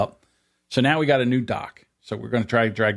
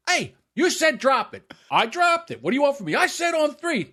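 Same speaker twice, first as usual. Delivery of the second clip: high, raised accusing voice; heated argument. Retold or imitated. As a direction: argue back hard with dad. Acted quarrel both voices.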